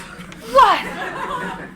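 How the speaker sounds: sharp high startled "what"; surprised. Acted. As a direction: caught off guard, pitch jumps up. Surprised at his confession.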